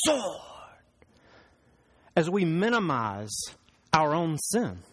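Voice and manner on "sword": high-starting, falling playful battle cry; mock sword-draw cry. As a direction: draw the word out like pulling a blade. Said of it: "pull out our sword and go".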